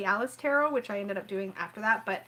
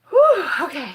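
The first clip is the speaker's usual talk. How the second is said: loud exhale; 6 semitones higher, 10 dB louder than usual. Real, not acted. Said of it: "steadying herself after shock".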